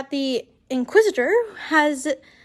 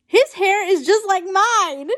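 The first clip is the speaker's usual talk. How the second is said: mild indignant whine, pitched higher; complaining; 5 semitones higher, 4 dB louder than usual. A narrator's reading. Draco protesting a joke about his hair, complaining. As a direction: complain with raised pitch, a little louder. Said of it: "Draco complains".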